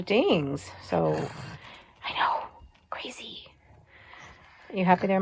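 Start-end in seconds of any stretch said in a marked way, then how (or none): 2.0-3.5 whispered I know and crazy. Real, not acted. Whispering it back to the dog. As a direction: breathe crazy out with no voice.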